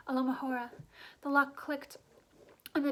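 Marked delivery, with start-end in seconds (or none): none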